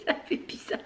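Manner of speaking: giggling through the words; laughing softly while talking. Real, not acted. Laughs at her odd knitting.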